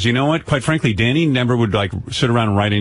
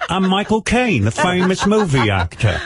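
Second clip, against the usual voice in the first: slow, high, hammed-up Michael Caine; Cockney movie-star impression, drawn out; Cockney accent; 6 semitones higher, slower than usual. Host introduces himself as a famous movie actor, Cockney accent. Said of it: "Oh, that's Cockney".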